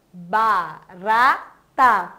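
Barata stretched very slowly, syllable by syllable. Shown as a demonstration. Teacher to children counting syllables, slowly drawn out. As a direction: break Barata into its three parts for the kids. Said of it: "Tem três pedacinhos".

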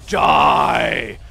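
low guttural death-metal "Die"; voiceless shouted metal growl; Idra's death metal growl. Retold or imitated. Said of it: "that's his death metal voice".

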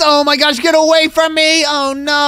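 high cry to back off; panicked shout. Pretend. Fleeing the crazy fangirl.

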